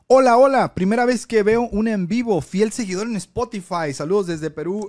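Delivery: host reading a fan's comment, loud and eager; excited reading. Real, not acted. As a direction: greet like an eager fan. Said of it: "reading a listener's message aloud".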